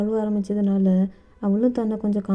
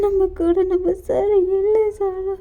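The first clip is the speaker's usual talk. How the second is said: anguished wail; 8 semitones higher than usual. Acted. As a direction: stretch the words out in high-pitched grief. Kukki repeats the bad news, grieving.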